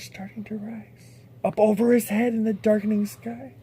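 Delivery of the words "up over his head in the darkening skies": usual speaking voice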